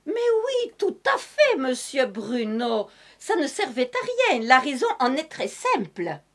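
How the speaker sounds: lightly put-on southern French accent with its sing-song lilt; a touch of southern French accent, hammed up for comedy. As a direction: play a cheerful Madame Masque with a hint of southern French accent. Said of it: "je continue avec cet accent du Sud".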